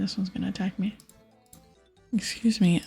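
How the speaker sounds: usual speaking voice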